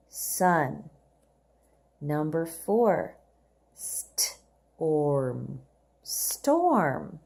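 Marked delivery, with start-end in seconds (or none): 3.7-5.6 slow model read. Shown as a demonstration. Teacher modelling storm slowly for the class. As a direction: storm drawn out long and low. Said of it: "Let's read the words together".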